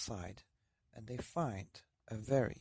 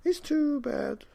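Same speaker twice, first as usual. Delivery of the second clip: put-on high, slow old woman sighing it out; old woman lamenting, high and slow; 7 dB louder, slower than usual. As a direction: let the old woman mourn it slowly.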